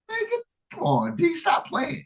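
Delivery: light high-voiced friend protesting; a hint of a pleading buddy; friend's protest. Friend insists he really plays tennis, lightly voiced high. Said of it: "So he was like".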